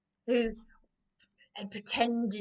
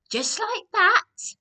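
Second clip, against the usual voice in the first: indignant; 10 semitones higher, 9 dB louder, slower than usual. Real, not acted. Emphatic retort about quick replies.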